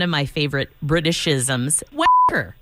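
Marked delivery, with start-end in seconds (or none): 1.9-2.5 gleeful exclamation. Real, not acted. Excited favourite Britishism.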